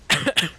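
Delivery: a harsh cough from a sore throat. Real, not acted. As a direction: cough hard and dry. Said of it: "I'm sorry for coughing".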